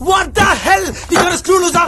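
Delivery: high-pitched indignant exclamation; angry outburst. Acted. Man snapping at his insulting date, high angry outburst. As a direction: blow up in outraged disbelief.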